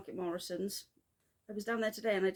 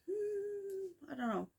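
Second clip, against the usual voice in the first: high, drawn-out skeptical hmm; unconvinced musing hmm; doubtful inner voice; 12 semitones higher, slower than usual. Retold or imitated. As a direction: voice your brain's doubt. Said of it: "my brain was like".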